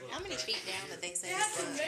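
high, loud excited question. Real, not acted. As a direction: ask it all worked up.

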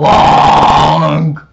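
sharp loud shout. Real, not acted. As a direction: bark it out loud. Loud correction.

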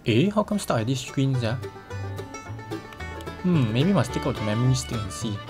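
usual speaking voice

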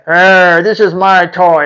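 mock dog growl; growling possessive dog. Dog claiming its owner as a toy, mock growling. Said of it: "He's saying".

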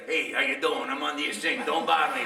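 a light, gruff Brooklyn Italian accent; lightly voiced grumpy Brooklyn Italian; rough Brooklyn Italian. Shown as a demonstration. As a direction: toss it off like a grumpy Brooklyn plumber. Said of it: "Italian plumber from Brooklyn".

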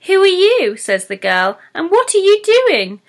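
put-on high, loud surprised girl voice; startled girl. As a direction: ask in shock at the stranger.

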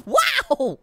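usual speaking voice

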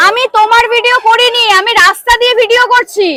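high, loud indignant protest; real angry shouting. Real, not acted. Vlogger confronts a man over filming in the street, angry shouting. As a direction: shout back in a heated street row.

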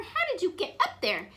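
high, loud, amazed question; astonished question. A narrator's reading. Elmer questions cousin up the tree, high startled tone. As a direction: ask it in storybook astonishment. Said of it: "gasped Elmer".

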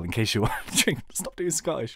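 ordinary delivery

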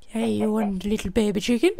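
high coaxing voice to a chick; cutesy baby talk. Acted. Gamer coaxing a baby chicken in a high cutesy voice. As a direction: coo at the little chicken.

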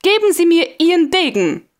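high, firm order; stern demand. A narrator's reading. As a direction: demand the sword. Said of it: "rief der schöne Major".

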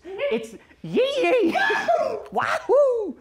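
high laughing yee-haw whoop; hammed-up Southern cheer; cowboy whoop.

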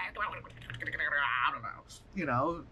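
usual speaking voice

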